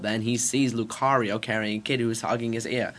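ordinary delivery

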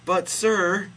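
high, loud character voice; high-pitched timid-woman parody; Mary protesting. A narrator's reading. Host reading Mary objecting to God, high-voiced. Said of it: "say Mary".

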